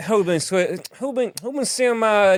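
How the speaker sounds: high, put-on Cajun voice; Cajun accent. Camper asking about stolen baskets, Cajun accent. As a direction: complain in a rough Cajun accent. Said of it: "He's got a sort of Cajun accent".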